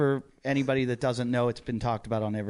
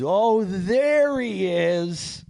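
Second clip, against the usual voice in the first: loud, high, long drawn-out jeer; imitated drunken heckle; mocking call-out; 8 semitones higher, 8 dB louder, much slower than usual. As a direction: announce his arrival to the whole room, stretching every word. Drunk comic calling him out mockingly.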